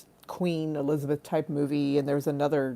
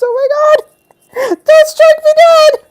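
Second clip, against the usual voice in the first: high, loud shriek building from talk; mock terror; 15 dB louder than usual. Comedian begging God after a blasphemous joke, mock terrified shriek. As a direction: wail in hammed-up dread.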